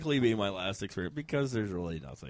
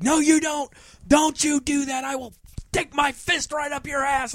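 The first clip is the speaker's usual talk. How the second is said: high, loud mock threat; yelling drag queen; 13 semitones higher, 5 dB louder than usual. Drag queen threatening Stu for yawning, hammed-up high yelling. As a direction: imitate an outraged drag queen, pitched way up. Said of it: "started yelling at Stuart".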